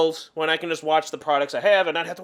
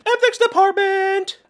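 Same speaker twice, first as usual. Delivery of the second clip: mock exclamation; 11 semitones higher, slower than usual. Mocking exclamation.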